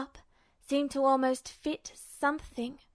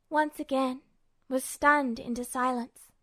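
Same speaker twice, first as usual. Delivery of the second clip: usual speaking voice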